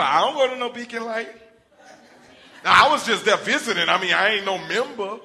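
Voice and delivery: loud, high, laughing excuse; loud half-laughing fib; nervous denial. Acted. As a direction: talk your way out of it fast. Peter lying about church membership.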